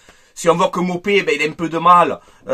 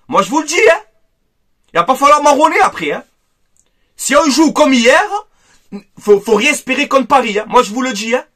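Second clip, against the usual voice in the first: angry warning; 6 semitones higher, 4 dB louder than usual. Real, not acted. Angry fan warning the team.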